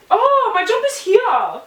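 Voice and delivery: high, drawn-out cry of surprise; surprised discovery. Real, not acted. Spotting the lost jumper on the couch, real surprise. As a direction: burst out high at a sudden find.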